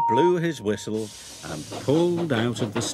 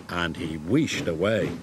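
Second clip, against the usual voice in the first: ordinary delivery, nothing marked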